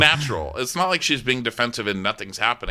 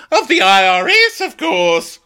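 high, loud hammed-up cry; mock shout; 9 semitones higher, 8 dB louder, slower than usual. Joking about what the ladies fear, mock shout. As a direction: blurt it high in mock alarm. Joke answer to fear question.

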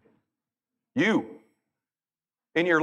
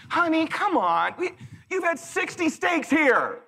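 exasperated pleading; 11 semitones higher, slower than usual. Retold or imitated. Husband pleading slowly.

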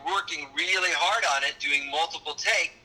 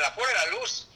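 Cuban Spanish accent; 5 semitones higher, faster than usual. Shown as a demonstration. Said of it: "the original Cuban".

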